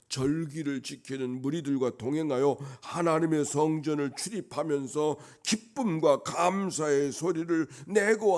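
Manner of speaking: loud, swelling pulpit delivery; full-force preaching. Real, not acted. Preacher recalls past worship with the crowds, full-force preaching. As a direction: build in long, insistent, ringing phrases.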